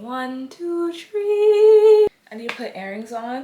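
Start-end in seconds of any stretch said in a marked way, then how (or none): 0.0-2.1 climbing to a loud high shout; rising shout. Pretend. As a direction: build up to a shouted count.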